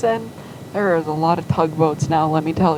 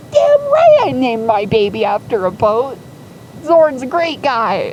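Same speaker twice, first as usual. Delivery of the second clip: loud, raised, emphatic defiance; defiant pride; 5 semitones higher, 4 dB louder than usual. Retold or imitated. Mother defending naming her baby after a boat, defiant pride. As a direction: own it proudly and dare anyone to object.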